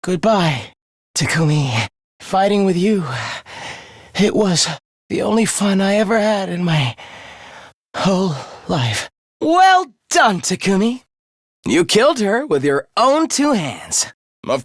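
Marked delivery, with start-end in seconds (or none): 0.0-9.1 weak, halting last words with long breaks; dying. Acted. Defeated villain bidding Takumi goodbye, dying and halting. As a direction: push the words out haltingly as life drains away.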